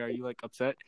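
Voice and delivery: usual speaking voice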